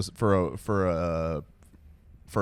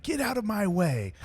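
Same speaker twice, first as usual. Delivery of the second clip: actor's line reading; 12 semitones higher than usual. Retold or imitated. An actor trying a line in the car, high acted reading. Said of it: "an actor reading a line".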